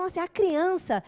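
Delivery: usual speaking voice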